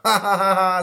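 high, loud mock laughter; exaggerated ha-ha-ha; jeering class laugh. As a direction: laugh derisively like schoolkids. Class jeers at Nasty Nathan.

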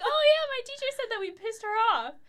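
put-on high, bright-eyed little kid; cheerful kid voice. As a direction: chirp it like an innocent child. Child voice in retold story.

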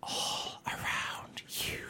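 slow breathy whisper. Shown as a demonstration. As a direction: whisper it slowly all around. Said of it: "That was really uncomfortable".

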